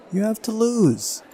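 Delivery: very high, slow put-on girl's voice; insistent girl character. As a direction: demand it in a high, drawn-out voice. Voicing Uliana insisting.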